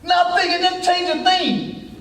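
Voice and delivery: high, drawn-out emphatic preaching; full-force preaching. Acted. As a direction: ring it out from the pulpit, stretching the words.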